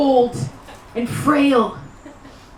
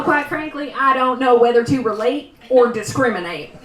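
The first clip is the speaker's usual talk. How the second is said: ordinary talk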